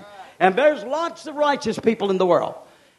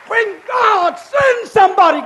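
shouted; 10 semitones higher, 6 dB louder than usual. Real, not acted. Shouted first take.

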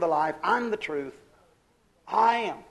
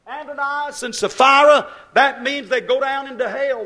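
loud, high pulpit warning; 4 semitones higher, 9 dB louder than usual. Real, not acted. Preacher warning the church of hell, loud and high. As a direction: hammer the warning home.

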